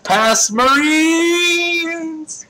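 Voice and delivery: drawn-out ghostly boast; slow, stretched ghost cry; spooky ghost voice. Pretend. Hams a ghost announcement.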